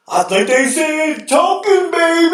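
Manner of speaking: high, slow, hammed-up shout on baby; overexcited broadcaster, stretch it out; Dick Vitale impression. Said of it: "it'd be like".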